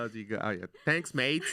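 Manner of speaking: Aussie "Thanks, mate"; Australian accent. Pretend. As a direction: say it like a cheerful Australian. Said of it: "ton accent australien est pas mal".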